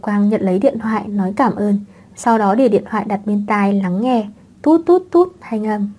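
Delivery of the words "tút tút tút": high, drawn-out phone beeps; imitated phone tone. A narrator's reading. Child listens on the phone, high imitated beeps. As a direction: voice the ringing line as even high toots.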